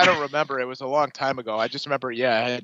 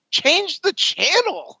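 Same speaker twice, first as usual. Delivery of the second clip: much higher, slow, emphatic, imitating himself; retold exasperated retort; 4 dB louder, much slower than usual. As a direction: drag it out like an exasperated son. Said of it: "And I go".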